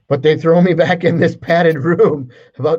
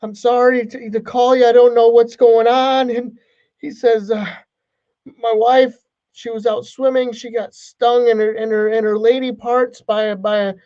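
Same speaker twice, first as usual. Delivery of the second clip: higher, frantic groom stammering on the phone; panicked husband; 9 semitones higher, 3 dB louder than usual. Retold or imitated. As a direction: play the new husband close to panic. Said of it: "He's like".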